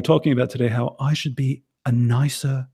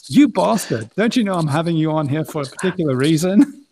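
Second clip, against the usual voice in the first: mock outrage; 4 semitones higher, 6 dB louder than usual. Acted retort to tech support.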